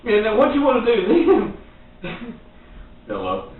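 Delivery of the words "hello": low, soft, lazy Hello; mock the bored clerk; Hello. dropped low and quiet. Shown as a demonstration. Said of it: "It cracks me up".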